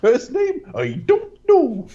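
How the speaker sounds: squeaky cartoon voice, very high and slow; silly high impression voice. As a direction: ham it up like a goofy cartoon character. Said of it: "your impression of everything else is".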